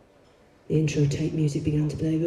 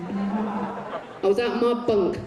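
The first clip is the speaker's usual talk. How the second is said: ordinary delivery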